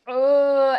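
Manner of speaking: high, disgusted ugh; disgusted groan. Retold or imitated. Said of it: "I was like".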